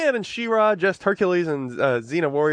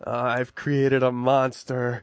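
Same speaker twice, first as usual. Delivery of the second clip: acting Walter; 6 semitones lower, much slower than usual.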